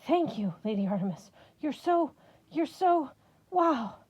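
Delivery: gushing, rising high and breathless; awestruck yammering. Acted. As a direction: babble your thanks to a goddess. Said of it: "started yammering".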